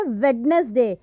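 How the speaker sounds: वेडनेसडे as WED-nes-day, D sounded; added D sound. Shown as a demonstration. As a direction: sound the D in वेडनेसडे, three syllables. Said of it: "डी की sound है हम उसको add".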